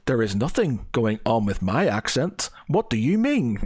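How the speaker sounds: "There is nothing going on" with every syllable sounded out; over-enunciated imitation. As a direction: imitate over-careful speech on "What do you mean". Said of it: "every word was being pronounced".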